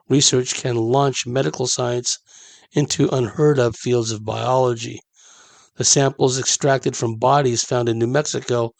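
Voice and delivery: usual speaking voice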